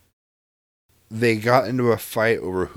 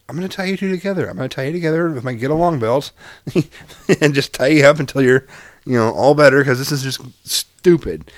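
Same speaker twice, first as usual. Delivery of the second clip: higher, mock-stern exasperation; exasperated threat; 5 semitones higher, 6 dB louder than usual. Dad threatening bickering kids with a get along belt, exasperated. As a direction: threaten the kids half-jokingly. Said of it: "And I'm just like".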